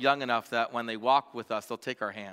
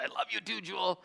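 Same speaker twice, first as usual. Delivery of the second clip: tender reply; 8 semitones higher, 4 dB quieter than usual. Retold or imitated. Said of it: "I said".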